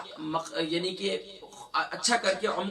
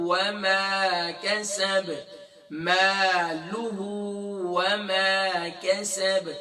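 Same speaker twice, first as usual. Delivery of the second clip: slow, elongated tajweed recitation; measured teaching recitation on a level note; Quran recitation; much slower than usual. Shown as a demonstration. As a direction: recite it with long held vowels. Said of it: "اب آئیے پڑھتے ہیں".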